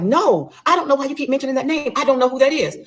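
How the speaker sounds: high, insistent retold protest; indignant denial, imitated. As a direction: imitate him flatly denying it. Husband denies knowing the woman.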